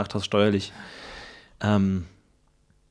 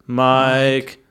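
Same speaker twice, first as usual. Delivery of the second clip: calling out; 2 semitones higher, much slower than usual. Acted. Ghost calling Mike repeatedly.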